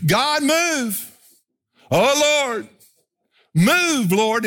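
high, whiny plea to God; mock pleading prayer. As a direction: beg God like an impatient child. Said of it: "We're going".

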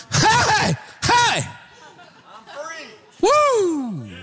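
high, jubilant monkey cries; high-pitched hollers of sudden joy; excited whooping. Acted. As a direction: whoop like you just got the revelation. Monkey gets the revelation, celebrates.

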